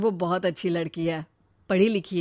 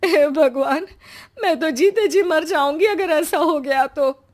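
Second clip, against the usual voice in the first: high, tearful lament; wailing despair; 6 semitones higher than usual. Acted. Mother horrified at begging on streets, wailing. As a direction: cry out to God, near tears.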